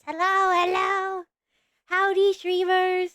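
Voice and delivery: high, drawn-out howdy; mock announcer hello; sing-song greeting. Streamer greets chat in a high, drawn-out put-on voice.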